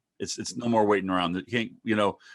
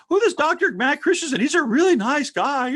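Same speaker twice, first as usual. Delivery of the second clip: put-on high girlish voice, much higher; imitating daughter; 4 dB louder than usual. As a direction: voice the daughter sweetly. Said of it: "and she says".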